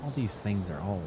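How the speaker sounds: ordinary delivery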